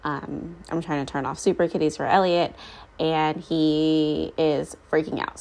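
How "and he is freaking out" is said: usual speaking voice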